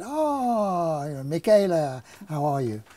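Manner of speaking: effusive Italian greeting, high and loud; big, high-pitched mock Italian accent; best Italian accent. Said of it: "I put on my best Italian accent".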